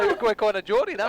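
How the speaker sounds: put-on Welsh accent. Welsh bit continues.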